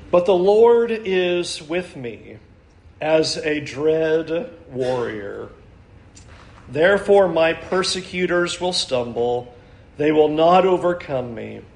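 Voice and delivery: slow, low, measured reading of the verse; solemn scripture reading. Acted. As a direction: read it with weight, slowly and low.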